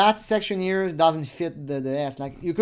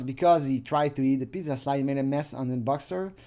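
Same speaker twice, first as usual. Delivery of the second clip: ordinary talk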